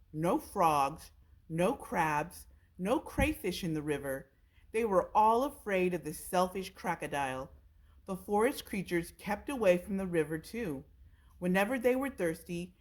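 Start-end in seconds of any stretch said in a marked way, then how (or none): none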